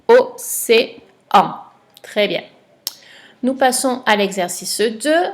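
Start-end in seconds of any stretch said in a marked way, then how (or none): 0.1-1.6 very slow, louder, stretched word; slow dictation. Shown as a demonstration. Teacher revealing the last word to a pupil, drawn out. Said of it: "c'était le mot".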